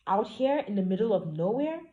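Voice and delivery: alarmed disbelief. Acted. Heroine alarmed in lonely field.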